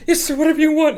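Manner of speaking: high, loud, meek yes-man squeak; a cartoonish simpering underling; whiny pushover voice. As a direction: play the spineless apprentice eager to please. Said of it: "Vakama's kind of a bitch boy".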